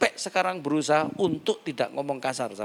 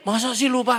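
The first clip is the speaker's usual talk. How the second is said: teasing woman caller; 10 semitones higher, 6 dB louder than usual. Retold or imitated.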